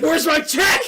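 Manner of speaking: high, loud demand; indignant outburst. Retold or imitated. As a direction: shout it like you've been shortchanged. Retelling outrage over missing pay.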